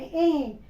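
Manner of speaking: "ए" sounded in the nose; nasalised vowel. Shown as a demonstration. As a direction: let "ए" ring through the nose as a nasal vowel. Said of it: "तो ये न की आवाज नाक में".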